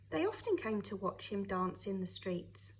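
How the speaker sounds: plain reading voice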